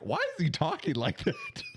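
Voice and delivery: high, put-on bafflement; mock baffled. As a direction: pitch it way up in disbelief. Speaker quotes her own bafflement.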